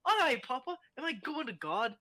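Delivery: put-on high-pitched Scottish child voice; high, innocent Scottish boy; Scottish kid. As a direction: ask Papa like a wee Scottish lad. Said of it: "turned fucking Scottish".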